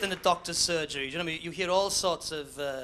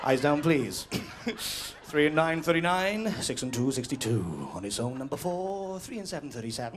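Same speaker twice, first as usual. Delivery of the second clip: bingo caller; 5 semitones lower than usual. Acted.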